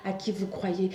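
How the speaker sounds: usual speaking voice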